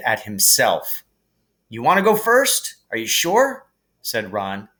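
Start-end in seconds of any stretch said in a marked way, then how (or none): 1.7-3.7 lightly voiced boy, surprised; a startled friend checking; a touch of surprised boy; Ron voice. A narrator's reading.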